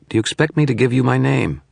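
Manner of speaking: scornful. A narrator's reading. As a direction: sneer the question down at him. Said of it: "the shadows scorned".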